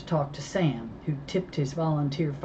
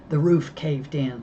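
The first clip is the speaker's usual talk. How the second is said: ordinary delivery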